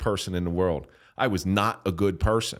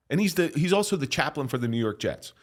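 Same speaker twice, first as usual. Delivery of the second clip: usual speaking voice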